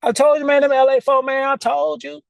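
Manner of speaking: high, told-you-so whine; high gloating I-told-you-so; old self nagging. Retold or imitated. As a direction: voice your petty inner skeptic. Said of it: "that's how he sounds sometimes".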